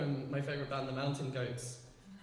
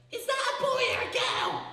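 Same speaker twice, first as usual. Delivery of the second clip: mocking shrill high-pitched boy's jeer; teenage boy voice. Teenage boy passing by, mocking high jeer. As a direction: squeak it like a jeering teen boy. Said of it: "In that voice, 'cause that's how teenage boys talk".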